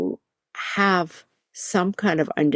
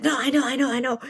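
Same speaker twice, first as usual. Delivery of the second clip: frustrated; 7 semitones higher, much faster than usual. Retold or imitated. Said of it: "he was so frustrated".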